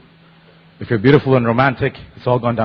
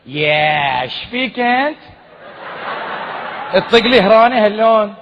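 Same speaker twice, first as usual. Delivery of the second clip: put-on Bahraini accent; 7 semitones higher, much slower than usual. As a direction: taunt him in a Bahraini accent. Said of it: "this is how it went".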